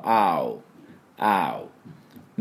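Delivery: アウ slow from the back of the throat; deep ow. Shown as a demonstration. Teacher showing the ow sound to learners, throaty. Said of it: "ゆっくり言う感じ。喉の奥で".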